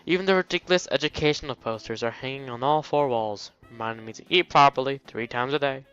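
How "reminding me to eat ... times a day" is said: ordinary talk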